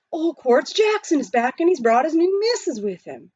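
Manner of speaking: mild excited call; calling the news. Acted. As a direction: spread the news to everyone. Miners calling across the stream.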